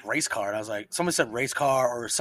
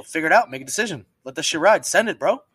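retold order; 4 semitones higher, 7 dB louder than usual. Barking orders to his guys.

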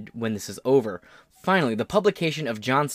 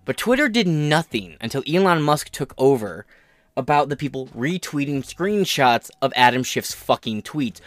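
ordinary talk, nothing marked

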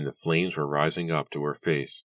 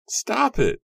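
even higher desperate shriek; shrieking louder; 6 dB louder than usual. A narrator's reading. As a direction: scream it with rising panic. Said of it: "Nora shrieked".

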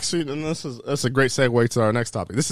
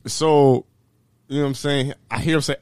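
usual speaking voice

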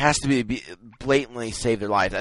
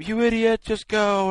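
mock yell, pitched way up; imitating yelling; 12 semitones higher than usual. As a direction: imitate an exasperated high-pitched outburst. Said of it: "Snape's yelling at him".

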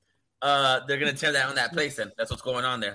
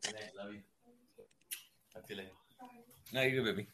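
hushed, much softer, off to the side; 6 semitones lower, 16 dB quieter, much faster than usual. Real, not acted. Talking aside to his child.

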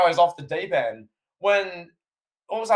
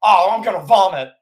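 louder, a lightly voiced disgusted commentator; Jim Ross impression; 3 semitones higher, 7 dB louder than usual. Quoting the commentator's reaction to a co-host, in a light Jim Ross voice. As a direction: throw in a quick Jim Ross groan of disgust. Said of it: "and his great Jim Ross".

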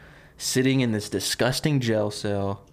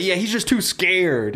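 mocking jeer; 7 semitones higher than usual. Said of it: "they're picking on him".

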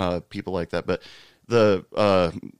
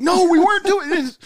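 very high, loud mock yelp; panicked denial; 8 dB louder than usual. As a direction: shriek a hammed-up flustered denial. Caught couple panics, acted out.

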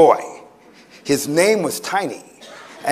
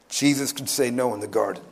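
ordinary delivery

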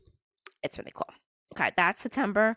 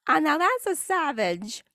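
amused admiration; 8 semitones higher than usual. Real, not acted. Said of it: "amused reaction after laughing".

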